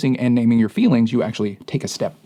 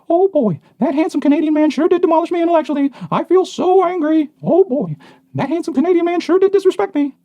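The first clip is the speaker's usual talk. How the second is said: put-on high, loud, goofy old-timey kid; fifties kid voice; 5 dB louder than usual. Shown as a demonstration. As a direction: chirp it like a gee-whiz 1950s boy. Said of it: "he calls it the fifties kid voice".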